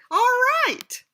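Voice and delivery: high, loud announcer call; announcer flourish. Real, not acted. Reader announcing the chapter to the audience, high and loud. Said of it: "announcing the chapter".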